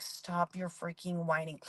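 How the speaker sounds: low, slow, quiet seethe; visceral reaction. Retold or imitated. Mom reacting to her son's whining, low restrained seethe. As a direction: hold the irritation down, drawn out and under the breath. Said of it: "just that visceral reaction".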